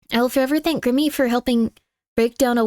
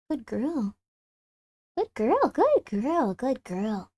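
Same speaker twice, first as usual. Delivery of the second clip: much softer murmured praise; soft cooing to cat; 6 dB quieter, much slower than usual. Real, not acted. Petting cat, cooing softly.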